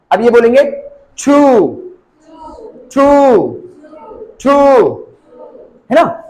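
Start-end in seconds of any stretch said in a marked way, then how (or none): 1.2-4.6 teacher modelling long oo in छू; long OO vowel. Shown as a demonstration. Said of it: "जो long को denote कर रहे हैं".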